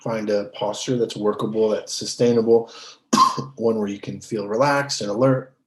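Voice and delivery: measured, settled meditation-teacher voice, leaving space between phrases; guided meditation. Real, not acted. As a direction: guide them unhurriedly into stillness. Leads a meditation.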